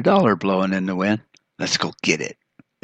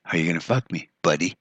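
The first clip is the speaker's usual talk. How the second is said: ordinary talk, nothing marked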